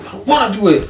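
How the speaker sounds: put-on high, loud, sulky kid whine; whiny little kid. Imitating a fifty-one-year-old friend whining like a sulking child. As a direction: whine it like a kid who won't do chores. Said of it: "like a little kid kicking the floor".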